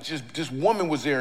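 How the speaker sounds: normal talking voice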